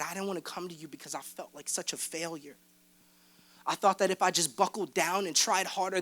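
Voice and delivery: hushed tearful confession. Retold or imitated. Confessing to Peter through tears.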